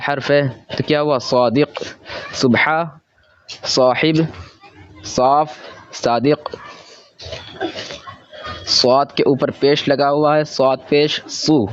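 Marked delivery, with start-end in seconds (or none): 1.2-6.5 सुबह साहिब सौफ read very slowly, each word apart; slow read-along. Shown as a demonstration. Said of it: "जिस तरीके से मैं पढ़ रहा हूँ". 10.5-11.7 स्वाद अलिफ ज़बर सौ spelled out slowly, letter by letter; slow spelling out. Shown as a demonstration. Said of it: "जिस तरीके से मैं पढ़ रहा हूँ".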